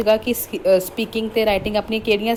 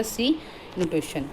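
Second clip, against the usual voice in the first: normal talking voice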